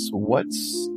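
ordinary delivery

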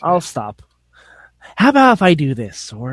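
lightly voiced Joker impression; a hint of the Joker, half-hearted. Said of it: "No more Joker".

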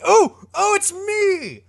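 very high, drawn-out excited claim; eager impostor. Acted. Impostor answers the newspaper advert for the missing heir, gleefully eager. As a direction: pipe up high and loud, stretching the words.

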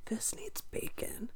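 whispered craving; whispering. Retold or imitated. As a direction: hush it like a secret. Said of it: "I was like".

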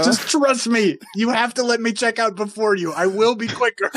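high, insistent voice hectoring a shopper; mock tirade. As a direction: pitch it up and insist, hammed-up. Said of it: "I wanted to yell at Ralph's".

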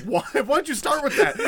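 cracking up while playing fed-up Gandalf; laughing exasperation. Host voicing Gandalf baffled by Pippin, laughing. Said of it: "and Gandalf's like".

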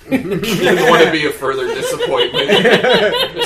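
slow, giggly deadpan joke; real laughter breaking up the joke; laughing through words. Real, not acted. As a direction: crack up while delivering the line. Cracking up at own joke.

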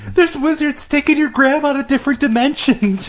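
high-pitched comic alarm; ranting like a conspiracy nut; mock panic. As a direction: raise a hammed-up alarm. Mock panic about wizards.

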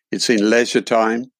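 normal talking voice

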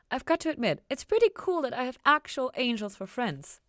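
Ella thrilled to have angel friends; excited Ella. Acted. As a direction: gush about angel friends. Ella delighted.

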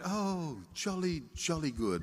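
understated "jolly good" English parody; English accent. Acted. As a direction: put on a very proper English accent. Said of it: "saying it with an English accent".

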